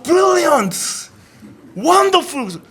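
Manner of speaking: professor's high, gushing brilliant wonderful; mock gushing praise. Pastor mimicking the professor praising a classmate, gushing and high. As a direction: gush like a delighted professor. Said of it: "최상급을 표현하시는 거예요".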